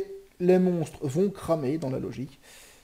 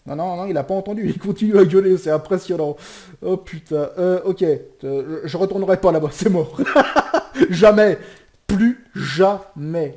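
loud, higher rattled rant breaking into a laugh; shaken excitement; 5 semitones higher, 10 dB louder than usual. Real, not acted. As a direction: laugh off the fright.

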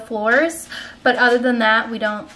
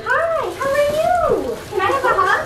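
pitched-up, delighted welcome; excited greeting; 10 semitones higher than usual. Real, not acted. Greeting friend and children on meeting.

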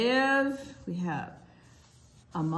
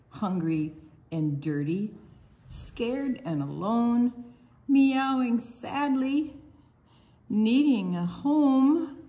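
slow, louder storybook refrain; read-aloud refrain; 4 dB louder, slower than usual. Acted. Librarian reading the kitten refrain to kids, slow and loud. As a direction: draw out each phrase for the little ones.

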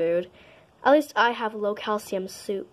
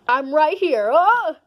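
sudden frightened shout; startled yelp; 5 semitones higher, 6 dB louder than usual. Acted. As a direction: jump with a yell. Friend startles the other.

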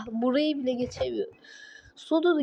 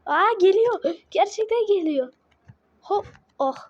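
high, louder burst of surprise; startled alarm; 6 semitones higher, 3 dB louder than usual. Real, not acted. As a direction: cry out as it really comes at you. Startled as danger approaches. (şaşkın, telaşlı, tiz ve yüksek sesle)

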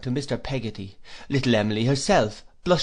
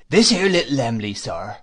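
ordinary delivery, nothing marked

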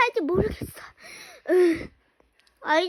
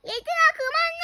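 shouting to stop; 9 semitones higher than usual. Acted. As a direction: yell for them to quit. Adult breaks up kids' fight.

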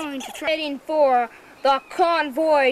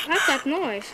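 ordinary talk

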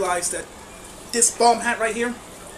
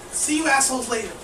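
ordinary delivery

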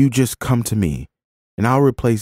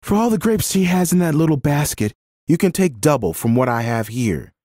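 higher-pitched, urgent pleading of the law; impassioned protest; 9 semitones higher than usual. A narrator's reading. Woman defending a gleaning orphan to the landowner, impassioned. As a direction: argue earnestly on the child's behalf.

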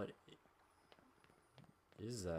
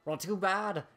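higher, lightly put-on old granny; a teasing granny taunt; grandma voice; 6 semitones higher, 4 dB louder than usual. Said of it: "Did my good grandma accent go away?".

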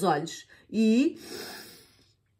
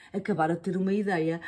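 plain reading voice

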